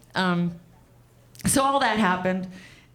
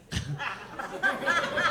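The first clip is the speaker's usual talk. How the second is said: quiet savoring chuckle; soft wicked laugh; 5 semitones higher, 5 dB quieter than usual. Acted. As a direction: laugh as if tasting revenge.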